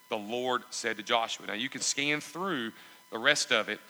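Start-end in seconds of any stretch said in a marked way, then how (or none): none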